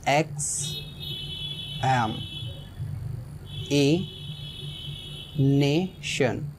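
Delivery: X एम ए nation spaced out with long pauses; syllables split apart. Shown as a demonstration. Teacher breaks examination into five parts for students, slow and spaced. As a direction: spell out the word syllable by syllable. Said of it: "five syllable बनेंगे".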